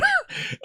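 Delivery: sudden high squeal of a laugh; shrill laugh. Real, not acted. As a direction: burst out in a high-pitched cackle. Bursts out laughing after the theme.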